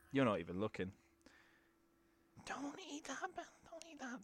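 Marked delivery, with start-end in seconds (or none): 2.5-3.5 put-on high sing-song baby talk. Talking to the baby. 3.7-4.2 put-on high, soft baby talk. Talking to the baby.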